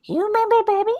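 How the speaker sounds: very high cartoon little-boy voice; Bobby. Shown as a demonstration. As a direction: squeak it like a cartoon kid. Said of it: "I can do Bobby from Bobby's World".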